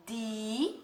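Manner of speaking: "di" held long on its own; isolated syllable. Shown as a demonstration. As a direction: stretch "di" as one separate part of a word. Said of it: "Falando parte por parte".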